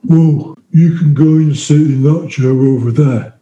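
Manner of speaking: a touch of the hulking inmate, grudging; lightly voiced brute giving in; menacing inmate. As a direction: point him to another seat, unimpressed. The huge inmate answers.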